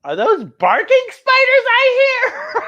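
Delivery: very high, slow squeaky voice then a laugh; a silly high-pitched joke voice; mock squeal. Storyteller joking about barking spiders in a squeaky voice.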